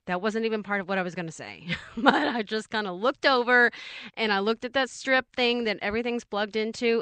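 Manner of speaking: ordinary talk, nothing marked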